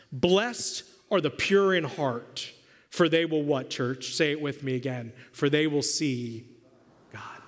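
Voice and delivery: scripture recitation. Acted. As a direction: recite it with the church.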